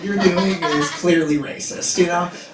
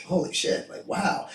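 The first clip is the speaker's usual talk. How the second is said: hushed amazement; half-whispered wonder at a kind surprise; awed near-whisper; 2 semitones higher, 7 dB quieter than usual. Retold or imitated. Son finding his laundry folded, awed near-whisper.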